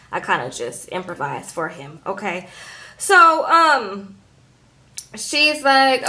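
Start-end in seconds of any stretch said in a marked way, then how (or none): none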